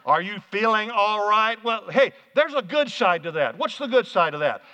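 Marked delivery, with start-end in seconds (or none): 0.0-1.6 drawn-out talk to the hard of hearing; really slow. Pretend. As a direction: spell it out slowly for a lip-reader. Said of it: "and really slow".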